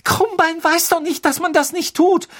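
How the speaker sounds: high, loud, upset defence; trembling voice. A narrator's reading. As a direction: plead the case with a quivering voice. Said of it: "sagte Hermine mit zitternder Stimme". (mit zitternder Stimme)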